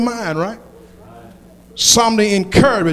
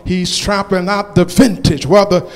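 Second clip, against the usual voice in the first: preaching at full force; 6 dB louder, slower than usual. Acted. Preacher rallying the congregation, full-force whooping sermon.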